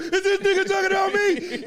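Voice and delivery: high, loud outburst over laughter; mock shocked reaction. Guest jokes to host about feeling called out, mock shocked. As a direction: blurt it out in mock alarm.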